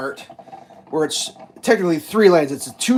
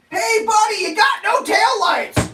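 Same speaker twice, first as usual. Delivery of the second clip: very high, slow shouted hey buddy; replaying the shout at the trucker; yelled warning; slower than usual. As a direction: call it across to the other car. Reenacting the yell.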